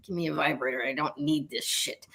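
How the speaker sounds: dry, fed-up throwaway; a touch of fed-up deadpan. Retold or imitated. Joking about swearing off relationships.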